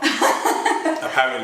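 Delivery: laughing nervously through the words. Real, not acted. Nervous before the challenge.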